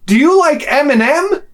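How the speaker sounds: put-on high, eager girlish question; imitated overfriendly girl; happy Norwegian girl. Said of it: "big smile, like, happy".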